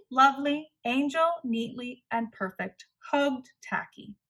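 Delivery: ordinary delivery, nothing marked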